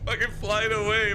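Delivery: giggling through the words; laughing. Real, not acted. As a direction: laugh it out high. Gamer laughing at flying car.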